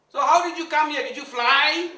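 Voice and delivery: high, loud, laughing taunt; mock her with a laugh; laughing disbelief. Guru laughing at the milkmaid, loud and high.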